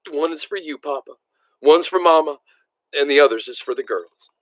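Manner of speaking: a mild choked-up voice, half-sobbing; choking voice. A narrator's reading. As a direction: hold back tears lightly while giving the gifts. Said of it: "in a choking voice".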